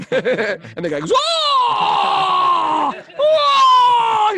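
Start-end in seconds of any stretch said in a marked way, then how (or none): 1.1-4.3 high, loud joyful cry of a guard; delighted shout. Retold or imitated. Guard thrilled by right answer.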